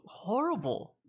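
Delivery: high, put-on character voice; Alistair. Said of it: "He just goes".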